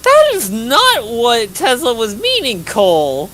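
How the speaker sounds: loud, high, slow shouted protest; shouting. Real, not acted. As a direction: yell it out in playful outrage. Teasing outburst at Cole.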